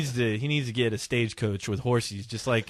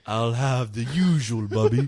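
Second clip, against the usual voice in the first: slow, lower suave vampire; 3 semitones lower than usual. Pretend. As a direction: order like a calm old count. Vampire ordering at drive-thru.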